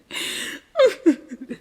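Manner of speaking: high teary laugh breaking through; embarrassed laugh. Real, not acted. Laughing through tears while apologising to viewers. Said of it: "get emotional".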